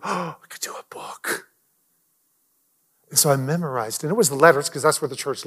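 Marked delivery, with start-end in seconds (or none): 0.0-1.4 awed whisper. Retold or imitated. Sudden realization while memorizing.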